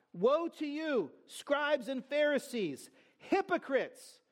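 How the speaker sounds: high, drawn-out cry of condemnation. Acted. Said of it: "He cries out".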